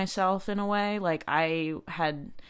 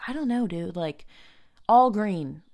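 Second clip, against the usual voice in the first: irritated; 3 semitones higher, 4 dB louder than usual. Retold or imitated. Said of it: "starting to get really irritated with him".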